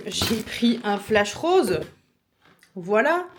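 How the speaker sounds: excited. Real, not acted. Reveals pink flash proudly.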